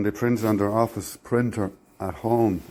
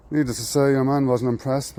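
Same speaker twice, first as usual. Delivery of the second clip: ordinary delivery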